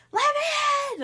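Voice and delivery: high, loud furious yell. Retold or imitated. Tom locked out, yelling at Lily through the door. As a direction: bellow it while slamming the door.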